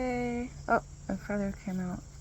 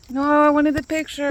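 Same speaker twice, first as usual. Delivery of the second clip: loud, whiny protest; exasperated, louder cry; frustrated cry; 5 semitones higher, 12 dB louder than usual. Real, not acted. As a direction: wail it like the shot is ruined. Pet ruins the photo.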